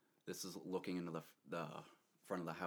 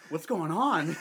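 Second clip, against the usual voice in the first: laughing alarm; 10 semitones higher, 14 dB louder, slower than usual. Retold or imitated. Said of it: "I was like".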